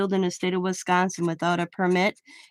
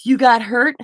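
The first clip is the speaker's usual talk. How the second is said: a loud scolding jab; louder, scolding retort; retold scolding; 4 semitones higher, 9 dB louder than usual. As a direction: bark it at the protesters. Imagined rant at protesters.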